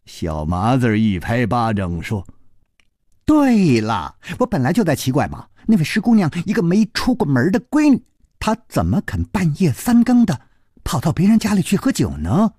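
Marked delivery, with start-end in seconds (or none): 3.3-12.5 drawn exclamation, then quick higher chatter; suddenly enlightened boy. Acted. Boy finally grasping the matchmaking, excited higher voice. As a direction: burst out with realisation, end in knowing hums. Narrator voices boy's realisation.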